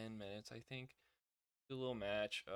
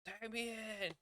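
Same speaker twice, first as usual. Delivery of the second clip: mock Miz plea; 9 semitones higher, 5 dB quieter than usual. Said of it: "he's like".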